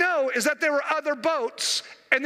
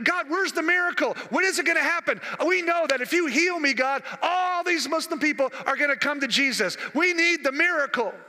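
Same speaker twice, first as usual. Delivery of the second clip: raised, urgent plea to God; pleading prayer; 3 semitones higher than usual. Retold or imitated. Sick missionary pleading with God for healing, urgent. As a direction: act out a desperate appeal for help.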